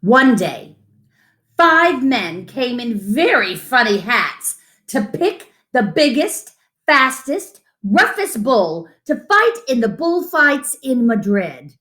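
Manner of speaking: unhurried picture-book read-aloud; reading to children. Acted. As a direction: read it to little ones, savouring each line.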